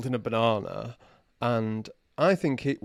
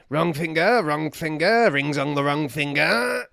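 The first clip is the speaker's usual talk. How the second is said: louder, rising mock correction; odd stranger scolding; 4 semitones higher, 6 dB louder than usual. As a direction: wag a finger like a playful eccentric. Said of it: "And he goes".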